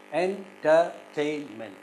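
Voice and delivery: slow "entertainment" split into four syllables; "entertainment" with each syllable set apart. Shown as a demonstration. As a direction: break "entertainment" into en, ter, tain, ment. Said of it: "how to syllabify this".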